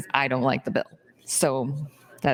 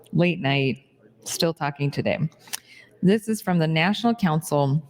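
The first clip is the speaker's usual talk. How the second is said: ordinary delivery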